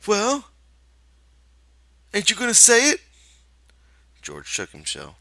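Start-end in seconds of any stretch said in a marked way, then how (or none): 0.1-3.0 put-on high, childlike confession; Lenny confessing. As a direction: admit it like a guilty child. Lenny urging.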